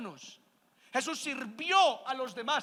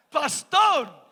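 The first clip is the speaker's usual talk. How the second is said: louder and higher hail; calling out; 4 semitones higher, 12 dB louder than usual. Retold or imitated. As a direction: call his name across the room. Church member calling again.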